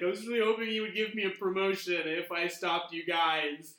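high, loud tearful complaint; a fed-up NPC near tears; upset whining. Acted. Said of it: "she was like".

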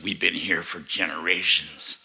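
low demonic voice from the belly. Retold or imitated. Demon in the man answers the pastor, low voice. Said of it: "this thing was talking out of his stomach".

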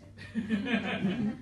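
slow, laugh-filled line. Real, not acted. Author laughing while reading about eating fish heads. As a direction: let the laugh take over.

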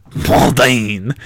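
Bourdain with the vowels pushed into the nose; nasal French try. Shown as a demonstration. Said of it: "I need to get more nose in it somehow".

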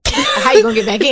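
high, loud doubting voice; mock the doubters, loud and high; incredulous people. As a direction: skeptics asking how she'll get back in. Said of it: "people are like".

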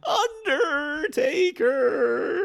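extremely drawn-out high wail; old wrestling manager. Acted. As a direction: hold every syllable in a high, eerie cry. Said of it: "just one more to make sure".

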